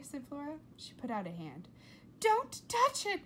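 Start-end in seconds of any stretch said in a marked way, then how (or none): 2.2-3.2 high slow frantic scream. A narrator's reading. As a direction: scream in panic. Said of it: "screamed her mother".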